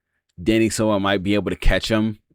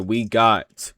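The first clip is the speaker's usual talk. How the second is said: ordinary talk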